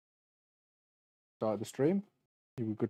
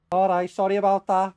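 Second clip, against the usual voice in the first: higher, louder breezy Scouse sorry; Paddy the Baddy; 8 semitones higher, 7 dB louder than usual. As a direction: careless Liverpool apology. Paddy walks off.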